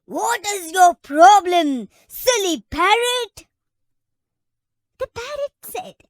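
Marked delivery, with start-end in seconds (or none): 0.0-3.5 angry elephant. Acted. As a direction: roar it down at the pest who woke him. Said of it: "The elephant got angry and said".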